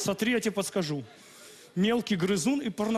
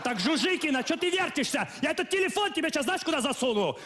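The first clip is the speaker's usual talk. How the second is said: higher, scolding the class; stern teacher yelling; 7 semitones higher than usual. Acted. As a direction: bark at the room like a strict teacher.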